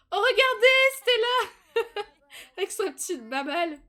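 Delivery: high, loud excited cry with a laugh; giddy laughing exclamation; delighted squeal. Real, not acted. Delighted at the dog on screen.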